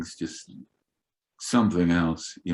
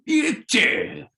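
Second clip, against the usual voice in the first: mock rock star yell; 13 semitones higher, 3 dB louder, slower than usual. Ted yelling while hitting fan.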